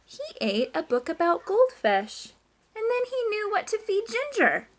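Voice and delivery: slow, emphatic storybook reading; reading to children. A narrator's reading. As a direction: build up the best bit for the class.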